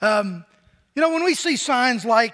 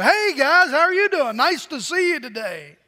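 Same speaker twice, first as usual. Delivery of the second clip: cheery greeting; 6 semitones higher, 5 dB louder than usual. Pretend. Planned cheery greeting to in-laws.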